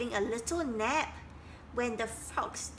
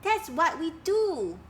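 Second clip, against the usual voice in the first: surprised protest; 6 semitones higher, 5 dB louder than usual. Acted. Dragonflies answer Duck.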